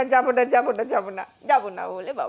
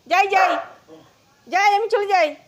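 higher, louder sing-song goodbye; teasing; 7 semitones higher, 4 dB louder than usual. Real, not acted. Owner teasing the pet that she's really leaving, sing-song. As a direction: tease the pet that you're leaving.

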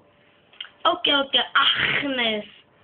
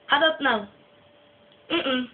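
normal talking voice

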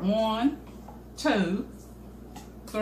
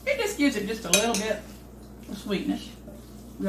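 talking through a mouthful of food; mouth full. Real, not acted. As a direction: speak around a bite of chicken salad. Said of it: "I'm talking with my mouth full".

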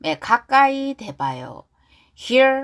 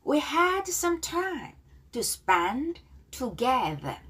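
slow model line; 5 semitones higher, 4 dB quieter, much slower than usual. Shown as a demonstration. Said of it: "자, 발음 따라하기".

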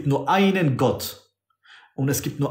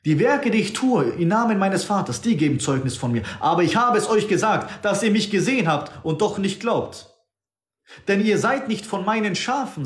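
higher, rebuking declaration; voicing Jesus; 7 semitones higher than usual. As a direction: lift the voice and reproach the crowd. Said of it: "Dann sagt der Herr".

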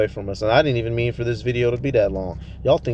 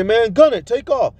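shouted, much higher, getaway order; urgent yell; 11 semitones higher, 5 dB louder than usual. Retold or imitated. Robber in the car yelling at the driver, urgent shout.

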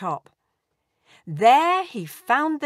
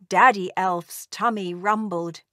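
plain reading voice

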